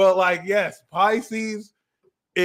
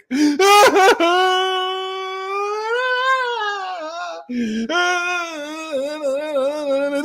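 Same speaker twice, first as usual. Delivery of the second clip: long high fake bawling; hammed-up drawn-out crying howl; mock wail; 13 semitones higher than usual. Host mimicking criers singing along to depression songs, mock bawling. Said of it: "These motherfuckers cry to the music".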